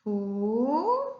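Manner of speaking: "Fu-" held long by itself; lone, drawn-out "Fu-"; isolated syllable. Shown as a demonstration. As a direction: stretch out "Fu-" as you would while writing it. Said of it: "Vamos separar essa palavra".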